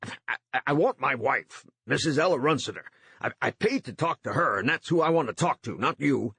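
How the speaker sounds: stumbling over the first words, insistent; stammering. A narrator's reading. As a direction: stammer out the demand for your wife. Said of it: "Stammering, Runciter said".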